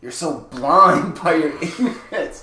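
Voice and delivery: loud, high mock scolding of fools; hammed-up indignant shout. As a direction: scold the crowd, half laughing. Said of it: "And I was like".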